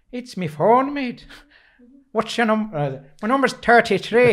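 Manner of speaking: playful Irish accent demo. Shown as a demonstration. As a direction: show off a good Irish accent. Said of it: "I can do a good Irish accent".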